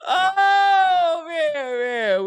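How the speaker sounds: high, loud "oh" sliding down; amused exclamation. Real, not acted. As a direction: let it fall away after a good laugh. Winding down after laughing at jokes.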